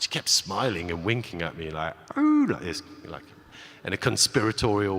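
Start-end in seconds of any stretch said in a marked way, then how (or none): 2.2-2.8 high ooh. Shown as a demonstration.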